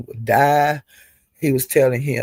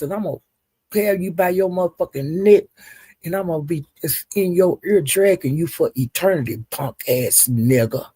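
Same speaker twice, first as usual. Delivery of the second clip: ordinary delivery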